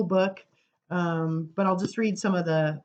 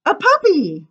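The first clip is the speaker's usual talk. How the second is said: eager answer; 12 semitones higher, 9 dB louder than usual. Acted. Child in poem answers eagerly.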